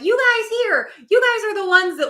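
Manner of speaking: high, loud insistent callout; emphatic acted appeal. Spirits acted addressing the living.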